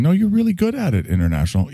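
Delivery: high-pitched imagined fan; reassuring viewer. Retold or imitated. Viewer reassures the host, high mock-insistent protest. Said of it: "you're probably going".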